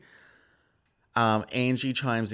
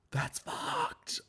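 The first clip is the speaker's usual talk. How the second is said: scandalised whisper; hushed mock horror; shocked whisper; 6 dB quieter than usual. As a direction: whisper it, soft and aghast. Shocked reaction to retold insult.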